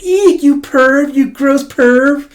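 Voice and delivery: very high, slow disgusted squeal; mock girly shriek. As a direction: squeal it like a grossed-out girl. Imagined girl calling him pervert.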